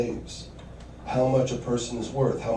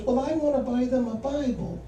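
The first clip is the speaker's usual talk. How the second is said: imitating mom; 10 semitones higher than usual. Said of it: "She goes".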